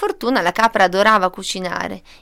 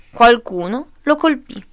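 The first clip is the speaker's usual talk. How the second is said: ordinary delivery, nothing marked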